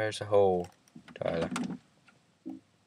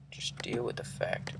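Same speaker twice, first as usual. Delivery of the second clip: whisper; 15 dB quieter than usual. Real, not acted. Teasing friend quietly.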